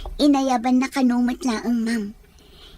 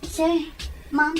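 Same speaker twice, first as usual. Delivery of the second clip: normal talking voice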